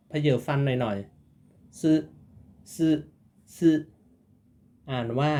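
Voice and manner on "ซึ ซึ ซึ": ซึ slow, tongue on palate, teeth slightly bared; careful tsu demo. Shown as a demonstration. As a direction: drill ซึ slowly, teeth showing. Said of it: "ลิ้นดุลเพดาน เผยฟันหน่อยๆ".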